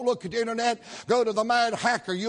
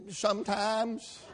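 sheepish; 7 dB quieter, slower than usual. Retold or imitated. Sheepish preacher's answers.

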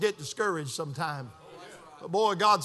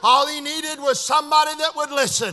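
raised, emphatic moral of the story; forceful preaching; 7 semitones higher, 7 dB louder than usual. Real, not acted. Preacher tells congregation someone only needed listening, forceful preaching. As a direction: drive the point home loudly.

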